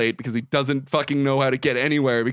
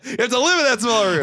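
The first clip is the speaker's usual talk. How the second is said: mock strict dad; 7 semitones higher than usual.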